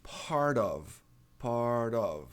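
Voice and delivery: "part of" run together with a tapped T, like "pard-of"; linked part of. Shown as a demonstration. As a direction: join "part of" and flap the T. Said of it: "Parte de".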